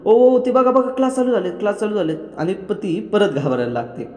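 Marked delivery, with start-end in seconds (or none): 0.0-2.2 high, loud mock alarm call; playful panicked shout that fades by the end; sudden teasing shout. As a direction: yell it out to startle her as a prank. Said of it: "मधीच तो ओरडतो".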